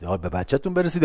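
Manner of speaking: usual speaking voice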